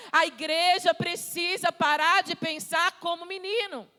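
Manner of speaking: forceful, building sermon voice; impassioned preaching. Real, not acted. Preacher urging the church to trust the Father, impassioned preaching.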